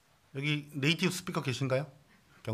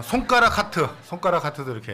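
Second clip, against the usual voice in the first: louder, higher, lively call-out; playful excitement; 5 semitones higher, 9 dB louder than usual. Real, not acted. Pastor livens up the congregation, loud and playful. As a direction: cheer them into the gesture.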